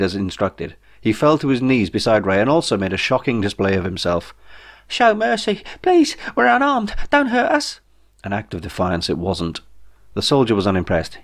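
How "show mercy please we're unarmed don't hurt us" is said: very high, pitiful pleading; shrill begging. A narrator's reading. Kneeling Jeff begging the soldiers, very high whimpering plea. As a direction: squeal for mercy like a coward.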